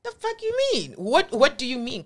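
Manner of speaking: retold indignant cry of disbelief; incredulous outrage. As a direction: jump way up in pitch, outraged and disbelieving. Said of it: "I'm like".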